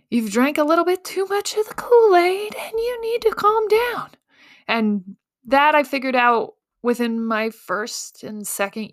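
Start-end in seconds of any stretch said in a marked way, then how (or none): 0.1-4.1 higher, mock-scolding family voice; scolding family member. Family scolding her for drinking the Kool-Aid. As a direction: play the worried relative telling her to calm down.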